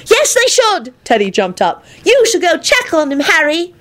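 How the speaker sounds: put-on a boy pleading eagerly; high, bright child urging. Teddy eager.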